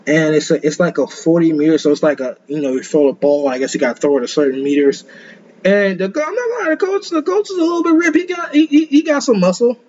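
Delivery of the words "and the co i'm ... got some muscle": much higher, faster, amazed gush; can't believe how ripped he is; excited. Real, not acted. Excited aside about the ripped coach.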